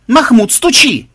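high, loud, unhurried order; loud command. Acted. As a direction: call out a command to a comrade. Ordering him to knock. (громкий приказ)

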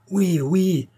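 high-pitched fervent cry; cri de foule. A narrator's reading. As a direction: shout agreement as a crowd. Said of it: "cria-t-on de toutes parts". (cri aigu de toutes parts)

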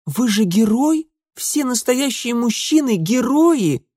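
high-pitched eager appeal; girlish gushing. A narrator's reading. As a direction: gush at the hero, voice pitched high. Sisters gushing at Mitya.

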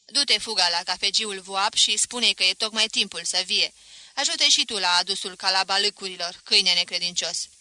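loud, harsh order to a servant; commanding master. Acted. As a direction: bark the errand like a scornful master. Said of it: "Săpânul îmi porunci".

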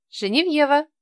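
high, worried maid voice; high insistent maid. A narrator's reading. Maid's voice.

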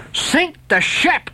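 high, very slow defiant shout; defiant cry. Acted. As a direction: fling it back at the captain. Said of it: "cried Steelkilt".

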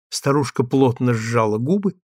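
ordinary delivery, nothing marked

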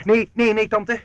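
high, loud protest; loud refusal. Acted. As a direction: nee nee nee, tante, shouting a boy's quick refusal.

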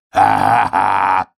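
slow villainous wolf laugh; slow, menacing acted cackle; волчий хохот. A narrator's reading. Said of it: "захохотал волк". (злодейский протяжный хохот)

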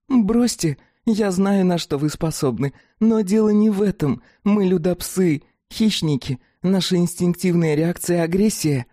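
high, insistent creature voice; insist, in the dog-woman's voice. Acted. Fatida insisting.

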